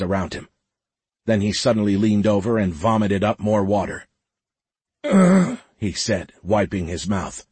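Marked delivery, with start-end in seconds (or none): 5.0-5.6 loud, high retching groan; strained, queasy "ugh"; disgusted groan. A narrator's reading. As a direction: groan as if just sick. Just vomited up water.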